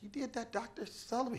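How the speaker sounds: an awed, breathless question; amazed. Retold or imitated. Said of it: "I was like".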